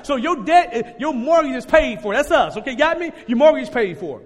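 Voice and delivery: normal talking voice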